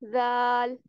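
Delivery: ज, ल in जाल said light and thin, not heavy; thin Arabic zaal demo. Shown as a demonstration. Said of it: "इसको बारीक पढ़ना है".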